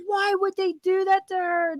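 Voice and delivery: playing the husband's outrage half in jest; mock mad complaint. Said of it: "he was mad".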